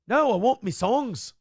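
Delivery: hammed-up British accent, indignant. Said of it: "I mixed up the accents there".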